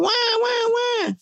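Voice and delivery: very high mock newborn wail; imitated baby cry. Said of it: "the only noise he knows how to make is wah".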